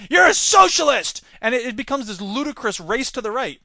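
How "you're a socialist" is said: loud and much higher, outraged; mock outraged Republicans yelling; accusing shout. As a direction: yell the accusation. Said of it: "the Republicans said".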